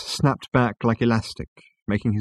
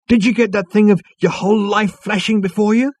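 high, loud, brash and eager; brash Zaphod voice; 13 semitones higher, 8 dB louder than usual. Acted. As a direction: blurt it out like a show-off.

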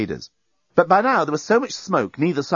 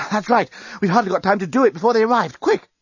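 hurried; 9 semitones higher than usual. A narrator's reading. Journalist hurried urgency.

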